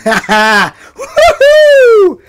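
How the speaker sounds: high shrieking woo-hoo through laughter; whooping laugh. Real, not acted. Cheering a fellow nerd with a laughing whoop.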